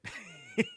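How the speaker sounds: short unvoiced laugh burst; breathy laugh. Real, not acted. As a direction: let a quick airy laugh escape. Laughs at own plan.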